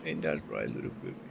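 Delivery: hushed, low cooing to a pet. Real, not acted. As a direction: murmur it softly to the cat. Softly cooing to cat.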